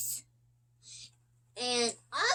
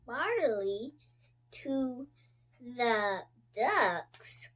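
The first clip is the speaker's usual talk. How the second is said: ordinary delivery, nothing marked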